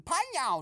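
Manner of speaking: high mimicked smack sound; imitated ricochet. Eavesdropper showing how it ricocheted off him, imitated ricochet. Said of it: "Sound like that".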